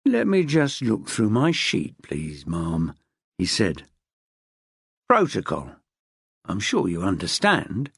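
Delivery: low, slow, smug official; savouring her frustration; smug desk man. Acted. Desk man stalling smugly.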